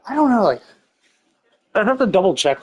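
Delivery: ordinary talk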